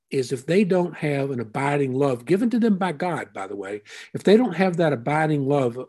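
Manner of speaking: usual speaking voice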